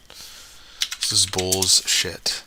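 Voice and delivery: slow, dragged-out annoyed grumble; drawn-out frustration. Real, not acted. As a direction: drag it out, fed up. Repeated, drawn out.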